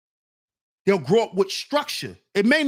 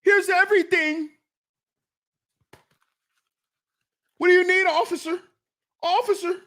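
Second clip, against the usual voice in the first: high, pleading, questioning voice; mock someone desperate to oblige an officer; hammed-up high-pitched submissiveness; over-eager deference to police; 7 semitones higher than usual.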